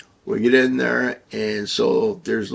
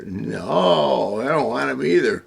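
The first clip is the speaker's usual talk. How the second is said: louder, higher, acted-out refusal; emphatic refusal; 5 semitones higher, 5 dB louder than usual. Other guys turning down the stripper, emphatic refusal. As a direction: refuse firmly, voice lifted.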